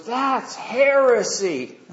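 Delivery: high, drawn-out mock cry of "heresy"; retold outburst of accusation. Camper jumping up to accuse the preacher, high drawn-out cry. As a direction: stretch it out like an outraged objector. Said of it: "this guy jumps up and he goes".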